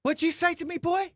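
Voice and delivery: high, mock menacing challenge. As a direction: play the bully looming over him. Retold angry confrontation.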